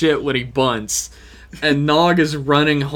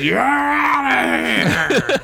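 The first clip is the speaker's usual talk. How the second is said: high, drawn-out umpire shout; imitated ejection holler; mock umpire call; 10 semitones higher, much slower than usual. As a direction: stretch it out like an umpire throwing someone out.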